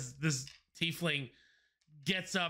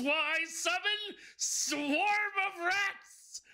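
grand spell call; 13 semitones higher, much slower than usual. Acted. Dramatic spellcasting.

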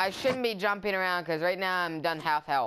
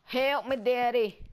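higher Southern plea; Southern accent; 3 semitones higher than usual. Acted. Playful plea to Daddy mid-game, Southern accent. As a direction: call for Daddy in a Southern twang. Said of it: "That's my southern accent".

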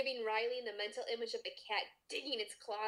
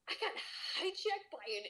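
disbelief; 9 semitones higher than usual. Acted. Riley disbelieving.

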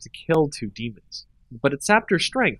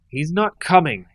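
harsh, ground out; 4 semitones higher, 3 dB louder than usual. A narrator's reading. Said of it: "ground out the words, her voice harsh".

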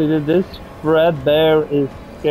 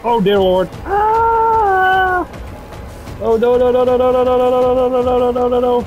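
panicked screaming; 9 semitones higher than usual. Real, not acted. Jump scare panic.

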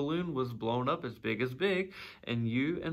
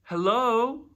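high, loud answering call; calling back; 10 semitones higher, 9 dB louder than usual. A narrator's reading. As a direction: call up to someone far above.

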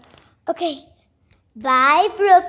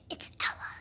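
soft breathy whisper of a name; 18 dB quieter than usual. Pretend. Doll whispers her own name to the group. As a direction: whisper it like a secret.

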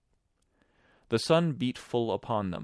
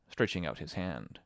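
plain reading voice

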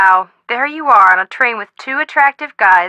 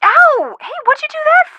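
sudden high yelp of pain, then an indignant protest. Acted. After her friend stomps on her foot, a high pained yelp.

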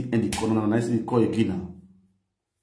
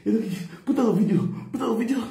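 shouting; 10 semitones higher than usual. Retold or imitated. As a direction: yell it high like he's losing patience. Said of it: "He dey shout".

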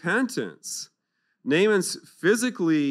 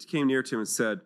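normal talking voice

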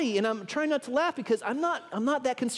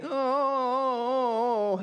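long, drawn-out moan, pitched up; imitated moan; 4 semitones higher than usual.

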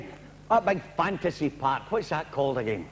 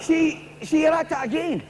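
accusing caller; 8 semitones higher, 3 dB louder than usual. Acted.

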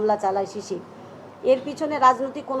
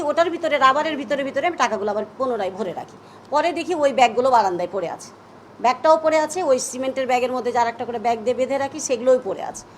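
normal talking voice

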